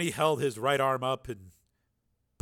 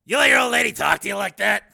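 high, loud taunting biker voice; mocking biker shout; 9 semitones higher, 13 dB louder than usual. A narrator's reading. Peacemaker taunting Steele about his old lady. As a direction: jeer at him in front of everyone.

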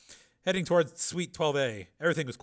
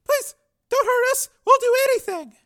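put-on very high, loud pleading girl; terrified girl; 12 dB louder than usual. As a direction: shriek in terror. Frightened girl.

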